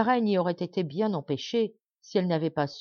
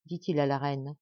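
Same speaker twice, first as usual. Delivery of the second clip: ordinary delivery, nothing marked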